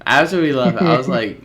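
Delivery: ordinary talk, nothing marked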